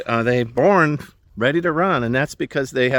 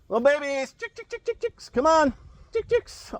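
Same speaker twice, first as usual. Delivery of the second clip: calling chickens. Real, not acted. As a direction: coax the chicks in a high voice.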